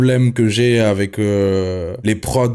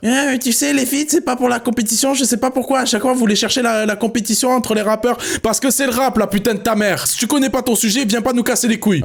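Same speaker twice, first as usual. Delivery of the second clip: much higher, sneering put-on voice; mocking high voice; 11 semitones higher than usual. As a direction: mimic the know-it-alls mockingly.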